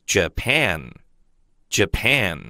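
Japan said slowly and clearly twice; teacher's model word. Shown as a demonstration. Teacher to students, slow careful model. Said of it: "日本英文就叫Japan".

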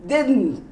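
"didn't" with the tongue up, barely an "un"; clipped didn't. Shown as a demonstration. Teacher drilling correct "didn't". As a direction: tap out "didn't" three times. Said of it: "Your tongue goes up on the un".

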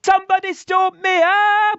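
high panicked shouts, fast; panicked yelling. Acted. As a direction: scream for help on a runaway bike. Runaway bike panic.

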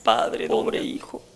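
slow, low mock-weeping wail; half-voiced, slow imitation of crying; imitated weeping. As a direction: play a sobbing mother, drawn out. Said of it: "Y la mujer llorando, pero llorando así".

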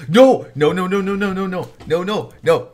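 high, loud rapid-fire panicked no's; panicked refusal. Real, not acted. Player dreading who is calling from behind, panicked. Reader dreads the approaching character.

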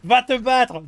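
loud, high bossy yell; shouting mother. Retold or imitated. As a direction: order the kid off to fight. Mother pushing son to fight.

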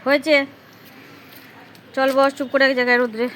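loud, high pet talk, coaxing the cat outside. Real, not acted. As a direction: call out to the cat, high and bright. Coaxing the cat loudly outdoors.

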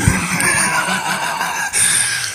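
unvoiced giggle; stifled laugh; breathy laugh. Real, not acted. Laughs after finishing bars.

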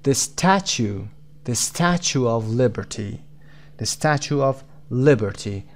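slow "Statue of Liberty", strong "Sta" and "Li"; stress on STA and LI. Shown as a demonstration. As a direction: lean on the first syllables of "Statue" and "Liberty". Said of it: "قسمت ستا و لیبرتی".

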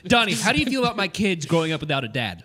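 hammed-up reproachful dad; mock guilt trip. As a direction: press a friend accusingly.

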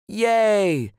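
high, loud, drawn-out cheer; big smiling whoop; joyful shout. A narrator's reading. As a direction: cheer like a delighted little girl. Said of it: "shouted Gracie, with a big bright smile".

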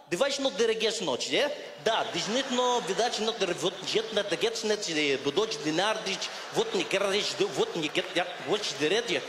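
rapid Russian-sounding gibberish; mock Russian. Russian on the phone switching languages, babbled mock Russian. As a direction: chatter away in imitation Russian. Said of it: "he switched from ... English Russian accent, into Russian".

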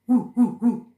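loud playful woof woof; mock dog barking. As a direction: bark like a dog for the kids. Teacher imitates dog after naming it.

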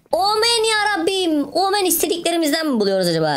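very high, sustained squeal of amazement; hammed-up excited squeal. Gamer finds wanted treasure, hammed-up high squeal. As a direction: squeal it way up high, stretching the exclamation.

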